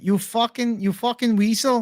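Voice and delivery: high-pitched attempt at an Irish accent. Streamer mocking Ian Garry, high Irish accent. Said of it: "How do you even do an Irish accent?".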